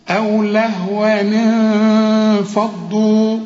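لهوا انفضوا linked by "ni", then a nasal hold; slow أو لهوا انفضوا flowing through the ghunna; joined recitation. Shown as a demonstration. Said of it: "هنكسر التنوين ده". (وصل لهوا انفضوا بكسر التنوين والغنة)

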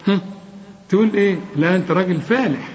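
ordinary delivery

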